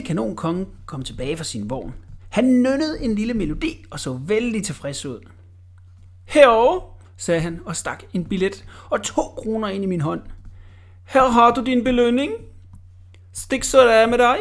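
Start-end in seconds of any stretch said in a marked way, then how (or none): 6.2-14.4 loud brusque. Acted. Cannon king dismisses the boy.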